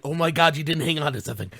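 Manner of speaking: usual speaking voice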